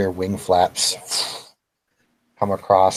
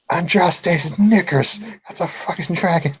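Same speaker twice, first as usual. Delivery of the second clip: shouting; 9 semitones higher than usual. Acted. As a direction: holler it in shocked disbelief. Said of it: "you just hear Alistair shout".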